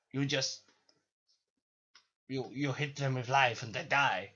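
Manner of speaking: lightly hammed-up French villain; French accent. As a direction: explain it with a put-on French accent. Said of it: "I'll stop doing the French accent".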